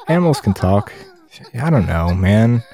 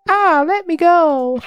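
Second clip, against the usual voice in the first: squeaky, much higher plea, drawn out; mock captive plea; slower than usual. Voicing the tied-up captive begging to be released, squeaky. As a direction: squeal it like a captured victim.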